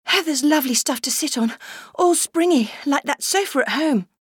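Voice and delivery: put-on high, eager little girl's voice; child voice. Little girl to her brother, high and eager. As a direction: chirp it like a delighted little girl.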